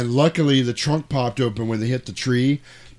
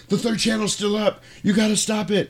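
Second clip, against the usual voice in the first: high, pleading urgent man; hammed-up panicked plea; frantic begging; 10 semitones higher than usual. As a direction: plead to stop the broadcast. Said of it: "And he's like".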